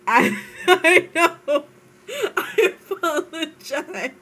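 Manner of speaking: giggly sorry, words broken by laughter; laughing too hard to sound sorry; laughing apology. Real, not acted. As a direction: apologize while you can't stop laughing. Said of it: "She says as she's laughing".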